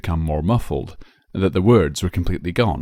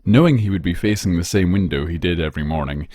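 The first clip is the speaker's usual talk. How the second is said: plain reading voice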